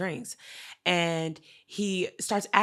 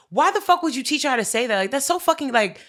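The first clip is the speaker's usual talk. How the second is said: higher, louder indignant re-enactment; retold indignant scolding; 7 semitones higher, 7 dB louder than usual. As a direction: tell him off, loud and incredulous. Retells scolding the owner.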